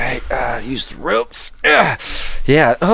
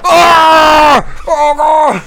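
pained yelp; 11 semitones higher, 4 dB louder, much slower than usual. Acted. Hit in the groin in game.

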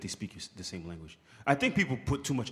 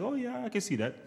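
mock agreeable; 12 semitones higher than usual. Man playing at giving her outfit feedback, mock agreeable. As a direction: put on a high, agreeable little voice.

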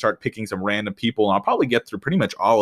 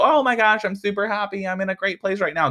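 much higher, gushing sing-song; mock happy gushing; 9 semitones higher than usual. Mocking people who feel super happy, high gushing voice. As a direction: play the blissed-out happy person. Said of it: "When you feel".

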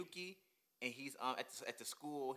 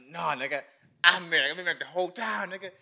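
loud mock villain reveal; hammed-up, emphatic impression; Merrick; 3 semitones higher, 8 dB louder than usual. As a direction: blurt the boast out loudly. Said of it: "and he was like".

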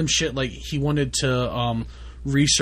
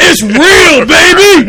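screaming, hyped-up party shout; hype yelling; 16 dB louder, slower than usual. Pretend. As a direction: yell it like you're wild.

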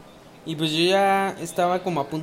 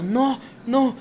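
half-voiced retold shout, pitched up; mock sleep shout; 5 semitones higher than usual. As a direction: mimic someone yelling in their sleep, held back. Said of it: "empieza a correr a la puerta gritando".

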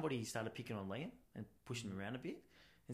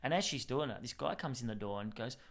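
usual speaking voice

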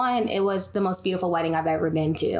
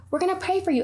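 sweet mock reassurance; 10 semitones higher, faster than usual. Imitating serene friend.

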